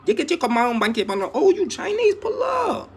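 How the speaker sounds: playful high mock shout; excited mock welcome. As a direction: hype them up to a shout. Acting out welcoming newcomers.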